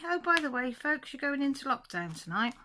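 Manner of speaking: lightly voiced, high and loud casual announcement; mock casual government message; offhand official, lightly mocked; a hint of a breezy official. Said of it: "Welsh Government to say".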